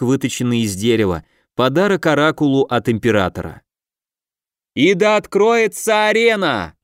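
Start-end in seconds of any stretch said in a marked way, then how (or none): 4.7-6.7 high, slow, ringing proclamation; prophetic trance voice. Acted. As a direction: proclaim it slowly like an oracle. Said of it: "Голос оракула окреп и прокатился".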